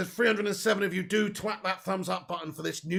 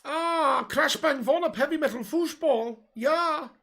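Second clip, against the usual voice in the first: put-on high-pitched, louder German accent; hammed-up comic German voice; 8 semitones higher, 5 dB louder, slower than usual. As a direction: cheer it as an excitable high German. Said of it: "I just always do Germans with a high-pitched voice".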